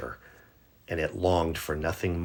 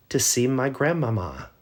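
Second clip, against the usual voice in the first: put-on higher, sweet child's voice; little girl voice; 7 semitones higher, 3 dB louder than usual. Little girl cheerfully answering the wolf, higher voice. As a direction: play the innocent little girl.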